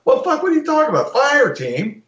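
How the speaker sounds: high, disbelieving scoff; incredulous outburst. Real, not acted. Scoffing at critics' fire-team talk, incredulous and high. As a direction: jump up in disbelief at the idea.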